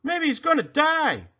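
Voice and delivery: a blackbird chirping a grim guess. A narrator's reading. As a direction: chirp it out like a small bird. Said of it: "chirped a blackbird".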